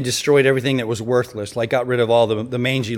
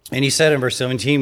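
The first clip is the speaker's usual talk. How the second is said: ordinary talk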